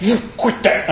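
sharp high scold to the computer; mock scolding a computer. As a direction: tell it off like a fussy kid. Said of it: "I said".

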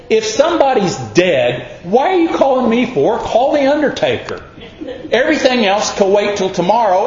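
rising high exasperated rant; mock exasperated. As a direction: build up in comic exasperation.

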